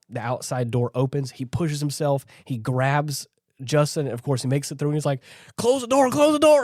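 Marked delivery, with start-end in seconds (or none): 5.6-6.6 high, loud retold yell; urgent cry pitched way up; frantic shout. Rescuer at the airlock after grabbing Justin, frantic shout.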